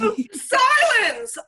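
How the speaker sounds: high, drawn-out cry of irritation; a fed-up witch; exasperated cry. Acted. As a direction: shut her up, at the end of your rope. Witch snaps at chattering girl.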